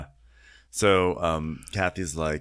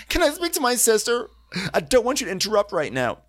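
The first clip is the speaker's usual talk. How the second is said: high, louder snippy mock voice; mocking Kyle; 14 semitones higher, 4 dB louder than usual. Co-host mimicking Kyle shutting Dorit down, high and snippy. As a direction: snap it back in a much higher pitch. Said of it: "And Kyle goes".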